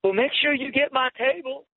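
high, bright-eyed squeal of a hopeful waitress; waitress calling out from the parking lot, high and thrilled; excited waitress. Retold or imitated. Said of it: "she goes".

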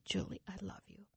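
very soft, hushed loving voice; voice in the night. Retold or imitated. Voice waking a sleeper in the night, very hushed. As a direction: imitate a faint voice from far away. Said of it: "And the voice said".

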